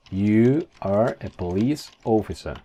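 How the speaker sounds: slow, separated words; careful slow English; slow model sentence. Shown as a demonstration. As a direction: spell it out word by word for learners. Teacher models English sentence slowly.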